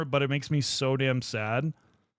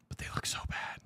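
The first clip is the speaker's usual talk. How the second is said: a pitying, secretive whisper; 11 dB quieter than usual. Real, not acted. Streamer to chat about a man's painted minis, whisper. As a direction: lean in and say it softly.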